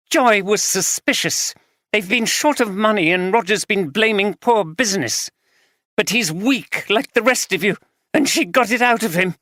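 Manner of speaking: the betrayed wife letting rip; wife accusing husband of gambling, furious; angry wife. Acted. Wife explains how she knew.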